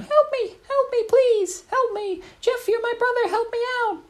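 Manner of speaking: very high, squeaky cries for help; little boy pleading. Retold or imitated. Little Johnny begging brother Jeff from the well, very high. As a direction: beg from the bottom of a well. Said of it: "little Johnny was in there shouting".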